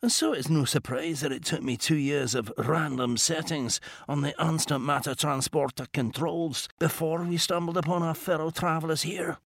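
quiet, dry and precise; a composed inventor gently scolding; calm Scottish gentleman. Acted. Mackenzie character dialogue.

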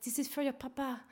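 put-on a sultry French hostess accent, lightly done; light imitation of a French accent; sexy French accent. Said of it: "in her most sexiest French accent".

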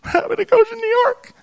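hammed-up sorrowful gossip; mimicking the visitor. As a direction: squeak it out like a gossiping stranger. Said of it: "And she says".

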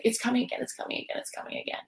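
repeated, dropping to a whisper; panicked repetition trailing off into whisper; fading panic. Retold or imitated. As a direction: let each repeat fade into a breathless whisper. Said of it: "and then it was like".

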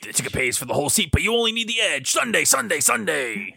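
high booming ad announcer; monster truck ad. Pretend. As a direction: hype the crowd like a monster truck commercial. Co-host joins the ad parody.

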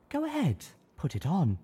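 a hint of a high lady mare; lightly voiced pony lady urging. A narrator's reading. Velvet voiced.

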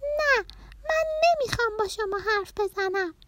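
put-on high-pitched stubborn child; child's tantrum "no"; loud refusal. A narrator's reading. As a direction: refuse like a cross little girl, very high. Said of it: "پرناز دوباره بلند گفت".

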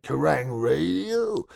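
slow puzzled drawl. Retold or imitated. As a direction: drag it out, baffled. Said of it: "And he went".

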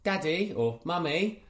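put-on high, whiny little-kid voice; imitating a pleading child. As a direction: beg sweetly like a toddler wanting cake. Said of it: "looks up at you".

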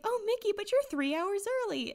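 very high cartoon girl voice; light falsetto mouse, surprised; Minnie Mouse. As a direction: pitch it high and squeaky. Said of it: "she's like".

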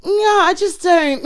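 put-on high, slow childish voice; imitating kids. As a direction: mimic a doubtful little kid. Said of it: "They're like".

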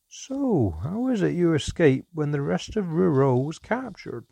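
a sly innkeeper dwarf fishing for answers; probing dwarf. A narrator's reading. Dwarf questions mother.

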